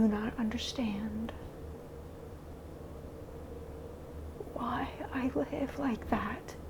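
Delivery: breathy whisper, almost no voice; despairing whisper. Real, not acted. Alone on camera, vlogger whispers her despair.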